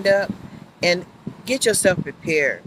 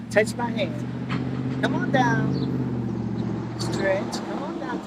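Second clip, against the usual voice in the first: softly coaxing a tree down; sweet-talking a shy tree, hushed; 2 semitones higher, 6 dB quieter, much slower than usual. Real, not acted. Speaking to a tree, soft coaxing.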